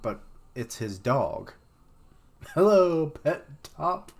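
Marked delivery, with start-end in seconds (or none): 2.5-4.1 Hello thrown out high and loud, then Pet Top; a goofy sing-song call to the dog; silly greeting. Shown as a demonstration. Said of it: "It's like Carrot Top".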